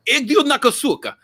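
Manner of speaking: slow Russian curse; Russian swearing, slow; Russian swear words. Shown as a demonstration. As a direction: spit out a Russian insult. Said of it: "swear words".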